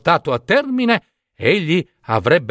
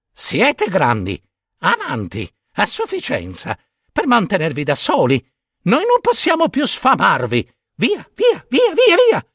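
parent ravens shooing; 7 semitones higher than usual. Acted. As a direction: shoo the chicks out, rising to shrill cries. Parent ravens chase chicks out.

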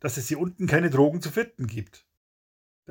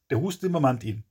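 plain reading voice

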